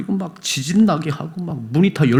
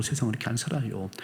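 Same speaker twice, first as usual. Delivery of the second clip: softer restatement; 11 dB quieter, faster than usual. Real, not acted. Preacher restating quietly to congregation, much softer. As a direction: say it again much softer, resigned. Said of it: "repeated statement, second".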